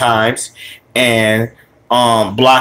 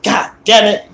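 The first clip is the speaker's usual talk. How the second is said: higher-pitched retold grumble; mildly pissed off; 6 semitones higher than usual. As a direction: act out a mildly annoyed kid. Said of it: "used to be pissed off".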